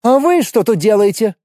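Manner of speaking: high, sharp stern question; a strict nurse scolding. A narrator's reading. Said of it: "строго спросила она".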